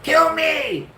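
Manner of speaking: high, loud mock scream; sleep scream. Wife screams from her nightmare, high mock scream. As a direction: shriek it like a nightmare.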